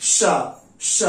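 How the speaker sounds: sustained श hiss; श as a long hiss, tongue folded near the palate; sh sound. Shown as a demonstration. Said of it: "तो आप इसको कैसे बोलेंगे?". (लंबी श ध्वनि)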